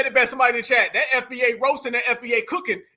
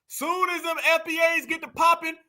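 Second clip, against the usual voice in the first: usual speaking voice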